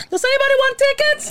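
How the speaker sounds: very high, goofy boss impression; exaggerated high-pitched persona; funny boss voice. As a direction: squeal it as a mock boss. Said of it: "funny voice when you were talking about me".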